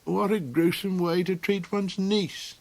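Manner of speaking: crying out. A narrator's reading. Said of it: "the Gollocks cried".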